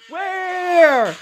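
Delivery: shrieking laughter; high giggling fit. Real, not acted. Laughing at streamer.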